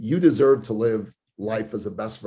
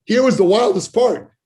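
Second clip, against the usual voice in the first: excited; 13 semitones higher, 7 dB louder than usual. Real, not acted. Excited climax of success story.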